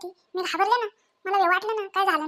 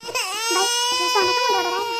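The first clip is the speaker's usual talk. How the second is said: put-on loud, worried call about the crying baby; alarmed cry; 4 semitones higher, 4 dB louder than usual. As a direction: raise your voice, fretting over the baby. (घाबरून मोठ्याने विचारणे)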